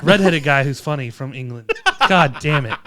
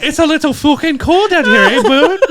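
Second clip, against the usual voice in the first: hammed-up Canadian, higher and laughing; mock Canadian accent; 9 semitones higher, 4 dB louder than usual. Poking fun at Canadians, a higher, laughing mock Canadian accent. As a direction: play a cartoon Canadian, half laughing.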